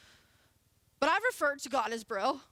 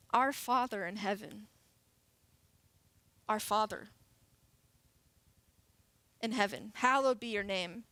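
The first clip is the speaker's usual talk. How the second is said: prayerful reading; 4 semitones lower than usual. Acted. Reads the Lord's Prayer.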